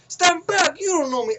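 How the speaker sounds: high, loud rap-style challenge. Retold or imitated. Young guy sounding like a rap song, mock defiant. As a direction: mimic a rapper's shouted hook. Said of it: "made me think of Ludacris".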